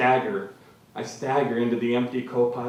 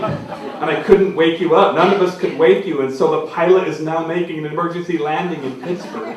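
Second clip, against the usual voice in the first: louder, words breaking into laughter; can't hold back the giggles mid-line; reading through real laughter; laughing while reading; 5 semitones higher, 6 dB louder than usual. Real, not acted. Reader laughs through absurd scene.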